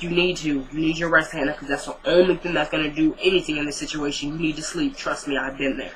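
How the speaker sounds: softly comforting a grieving friend; hushed, gentle reassurance; soft soothing voice. Retold or imitated. Catherine soothing grieving Hannah.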